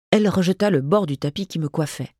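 usual speaking voice

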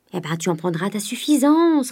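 plain reading voice

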